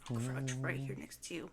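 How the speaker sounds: very soft, low undertone; low, barely-there voice; hushed whisper. Real, not acted. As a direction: keep it hushed and close. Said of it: "Whisper, whisper".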